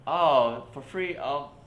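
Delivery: high, loud surprised offer to pay; mock surprise. Retelling how the tourists reacted, high and surprised. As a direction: sound caught off guard and overly polite.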